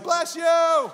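high shouted Bless you; wife's high shout across the restaurant; loud call across room. Retold or imitated. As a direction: call it out to a stranger four tables back. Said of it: "Lori's like".

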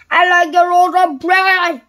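high, very loud boom. A narrator's reading. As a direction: boom out the order at full volume. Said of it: "boomed Mister Noisy".

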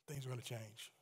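soft echo. Shown as a demonstration.

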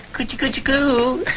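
put-on high, soft baby talk to a toddler. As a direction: coo at the baby sweetly. Cooing at baby.

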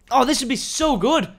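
high, loud burst of excitement; excited. Real, not acted. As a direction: gush over a brand-new idea. Thrilled by own new idea.

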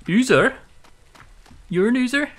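high, loud startled cry; shocked yelp at the monster. Real, not acted.

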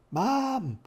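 high, loud call for help; frightened shout. A narrator's reading. Robbie calling Mom to dying old Da, frightened shouting. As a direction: shout for your mother in alarm. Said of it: "shouting, for he was in real fear now".

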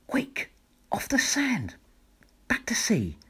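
high urgent whispered snail; tiny snail urging the whale; snail's urgent cry. A narrator's reading. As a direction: hurry the whale back to sea. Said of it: "cried the snail".

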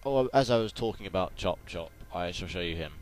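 ordinary talk

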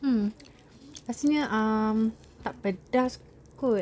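talking while chewing food; slightly muffled by chewing; mouth full, chewing. Real, not acted. Seen 1: Lips pressed, chewing motions between words; she ate skin earlier.

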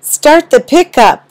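high, loud urgent order; urgent call. Acted. Husband urges wife to hurry to the store, loud urgent call. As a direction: rush her to start the pickup. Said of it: "I said".

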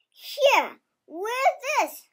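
put-on child's light goat voice; goat bleat. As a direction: pipe it up like a small goat. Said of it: "bleated the small frilly goat Fluff".

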